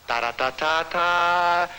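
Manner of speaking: slow, drawn-out vocal ta-da; imitated fanfare. Storyteller heralding the gang, slow imitated fanfare. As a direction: sound out a fanfare with the voice.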